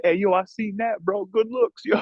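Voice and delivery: high-pitched, hyped-up dude giving props; imitated admiring stranger. Random dude in the bathroom praising him, imitated high and hyped. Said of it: "Some random dude in the bathroom was like".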